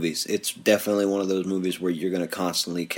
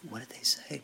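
whispering; 5 semitones higher, 12 dB quieter than usual. Retold or imitated. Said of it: "we were every once in a while like".

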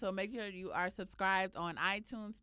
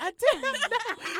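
laughing; 12 semitones higher, 12 dB louder, slower than usual. Real, not acted. Joking claim of innocence.

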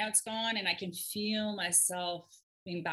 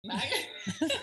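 surprised; 10 semitones higher than usual. Real, not acted. Surprised at the yawning dog.

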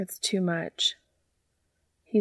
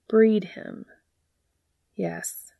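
ordinary delivery, nothing marked

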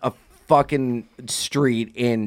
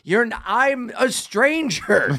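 higher, slow disbelieving plea; exasperated driver; 8 semitones higher, slower than usual. Retold or imitated. Retelling the truck driver refusing a drunk girl, mild exasperation. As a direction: lightly voice the fed-up driver. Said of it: "And the guy's like".

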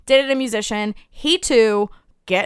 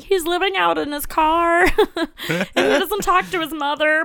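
high, laughing, lovestruck rationalizing; laughing, smitten; 6 semitones higher than usual. Retold or imitated. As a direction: giggle through the excuses. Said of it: "I'm like".

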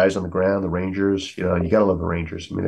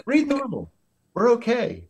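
much higher voice, steady and calming; calm command; slower than usual. Retold or imitated. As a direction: reassure the breathless guy, slow him down. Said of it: "He goes".

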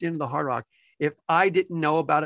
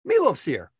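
high, loud excited reply; thrilled to hear the news; 14 semitones higher, 5 dB louder than usual. Retold or imitated. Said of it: "And I'm like".

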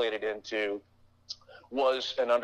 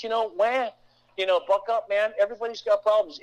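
mock whining dismissal; 8 semitones higher than usual. Mocking whining, tough love.

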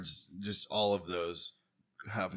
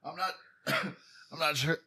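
coughing; 4 semitones higher than usual. Real, not acted. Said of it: "wait on you to stop coughing".

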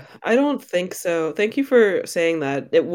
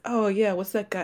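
higher-pitched wondering; puzzled bystanders; 6 semitones higher than usual. Retold or imitated. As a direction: wonder aloud like the group. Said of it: "everybody's standing there going like".

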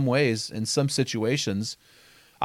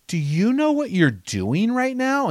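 high, slow, pointed question; stern pastor; 9 semitones higher, slower than usual. Retold or imitated. Children's pastor confronting a boy, slow and high.

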